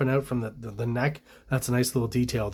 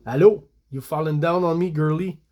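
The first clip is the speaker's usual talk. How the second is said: louder French Canadian kidnapper voice; French Canadian accent; 3 semitones higher, 4 dB louder than usual. Acted. Kidnapper to the girl he carries, French Canadian accent. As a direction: greet her in broad French Canadian English. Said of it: "with a French Canadian accent".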